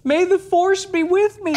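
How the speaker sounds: high mocking Luke; Luke quote. Quoting Luke's odd line to the others, high and mocking. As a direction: pitch it high, mock heroic. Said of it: "So he says".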